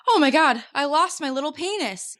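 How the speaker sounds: high, excited mock panic; mock panicked Jason. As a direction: play him flustered, hunting his pocket. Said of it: "He's like".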